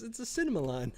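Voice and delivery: said through a laugh. Real, not acted. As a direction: laugh while saying it. Repeats the phrase, then laughs.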